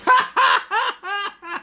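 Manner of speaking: real high giggle; high burst of laughter. Real, not acted. Reviewer cracking up at the punchline, high giggle.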